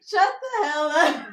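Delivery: mock scolding. Scolding friend's dirty joke.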